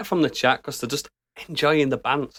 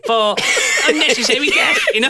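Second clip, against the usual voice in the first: announcer voice; 12 semitones higher, 3 dB louder than usual. Acted. Mock award announcement.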